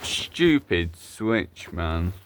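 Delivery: usual speaking voice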